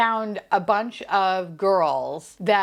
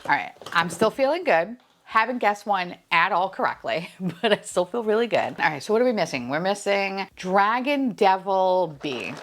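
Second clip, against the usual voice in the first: normal talking voice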